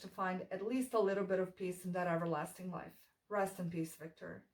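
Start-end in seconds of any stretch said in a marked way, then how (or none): none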